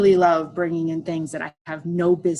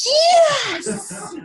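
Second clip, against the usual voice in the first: huge high, drawn-out triumphant yell; excited victory shout; 5 dB louder than usual. Retold or imitated. Husband hearing his count is the highest, ecstatic shout. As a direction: shout it like you just won, pitch way up. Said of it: "He's so excited".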